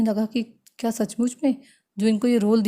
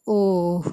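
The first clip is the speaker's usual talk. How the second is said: long, drawn-out groan of hopelessness; despairing "oh". Shown as a demonstration. Teacher shows how someone without hope says it, despairing groan. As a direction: let the "oh" sag out hopelessly. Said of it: "जब despair में होता है".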